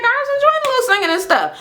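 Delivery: light innocent imitation of Minnie. Shown as a demonstration. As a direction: play it sweet and harmless. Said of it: "it didn't sound like she was minimizing it".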